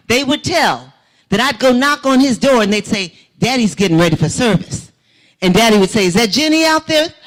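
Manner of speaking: preaching. Real, not acted. Woman testifying to church about her father, loud preaching. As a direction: preach it to the congregation.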